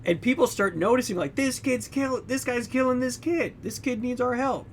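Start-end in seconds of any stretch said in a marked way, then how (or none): 1.3-4.7 alarmed bystanders. Retold or imitated.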